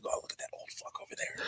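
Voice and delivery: hushed mocking whisper. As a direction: whisper it like teens judging. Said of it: "they're like".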